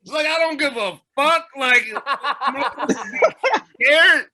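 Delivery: high, loud mocking laugh in the words; imitated incredulous, laughing taunt. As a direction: laugh in his face, imitating a scornful friend. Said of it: "starts busting up laughing in his".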